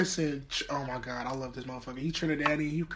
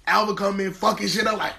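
ordinary talk

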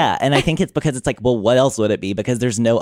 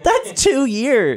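high, slow, disbelieving; incredulous; 14 semitones higher, much slower than usual. Real, not acted. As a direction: drag it out in disbelief. Insisting the age gap is small.

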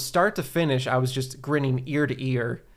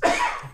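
choking cough after water went down wrong; sudden real cough, water in the windpipe. Real, not acted. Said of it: "Do you need a minute".

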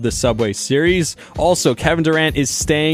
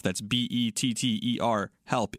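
softer, brisk sponsor read; steady, softer podcast ad read; 8 dB quieter than usual. Acted. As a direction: read the ad like a smooth host spot. Host reads the sponsor ad.

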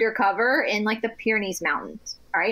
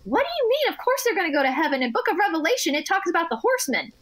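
higher-pitched, matter-of-fact retort; lightly voiced practical mom; 7 semitones higher, faster than usual. Mom reassuring her upset child about pets in heaven, matter-of-fact. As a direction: imitate a mom brushing off nonsense. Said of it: "this, like, very common sense, practical".

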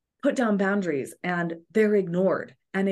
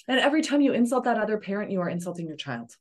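ordinary delivery

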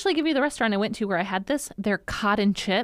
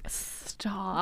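whisper; 3 semitones lower, 10 dB quieter than usual. Real, not acted. Whispered amazed reaction.

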